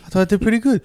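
ordinary talk

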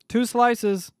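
high, loud call; yelling. A narrator's reading. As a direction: yell the order. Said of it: "I yelled".

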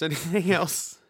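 ordinary talk

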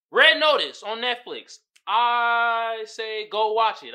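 slow, loud, deliberate recommendation; loud, slow announcer-style plug; slow emphatic pitch. Real, not acted. Announcing the movie verdict.

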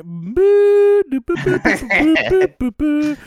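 high loud boo noises bleeping a name; vocal censor bleep. Acted.

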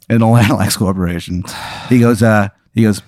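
ordinary talk, nothing marked

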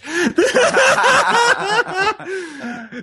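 high-pitched laugh; high burst of laughter. Real, not acted. Host laughs at botching the page name, high laugh.

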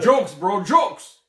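triumphant punchline yell; excited shout. Real, not acted. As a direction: crow about the joke, building to a yell. Boasting about his joke.

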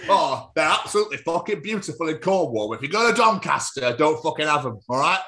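loud, emphatic mock Cornish accent; forceful comic West Country accent from Cornwall; Cornish impression. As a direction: rant as a put-on Cornishman. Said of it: "I just wanted the Cornish impression back".

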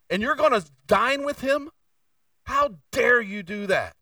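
preacher acting out the grumbling disciples, outraged; ticked off. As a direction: demand it, scandalized and angry. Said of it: "They were ticked".

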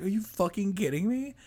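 high-pitched mock disbelief; retold anger. Retelling the reaction to sister's air fryer, mock indignation. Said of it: "I'm pissed".

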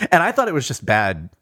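usual speaking voice

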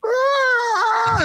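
high shrieking monster wail, imitated; sudden pained monster yelp, mock; whining kaiju cry. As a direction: screech it like a wounded Gamera. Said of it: "you hear his whining".

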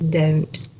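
"don't" with a gliding OH-oo vowel; Southern American "don't"; Southern pronunciation. Shown as a demonstration. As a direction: slide the O in "don't" towards oo. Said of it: "how you would probably say it now".

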